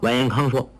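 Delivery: ordinary delivery, nothing marked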